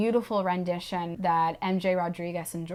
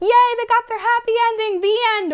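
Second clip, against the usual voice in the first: higher, louder fake cheer; mock cheer; 12 semitones higher, 4 dB louder than usual. Telling the friend the happy ending with mock cheer.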